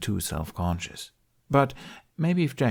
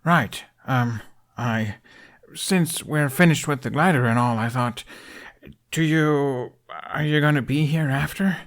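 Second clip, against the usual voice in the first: flustered stammer; 6 semitones higher, 3 dB louder than usual. A narrator's reading. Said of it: "He stumbled over his next words".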